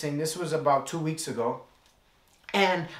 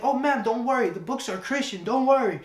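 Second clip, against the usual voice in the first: eager reassurance; 7 semitones higher, 4 dB louder than usual. Retold or imitated. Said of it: "And I was like".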